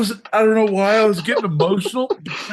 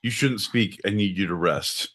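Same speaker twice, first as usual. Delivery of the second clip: mock soothing; 13 semitones lower, 4 dB quieter than usual. Soothing partner, shh.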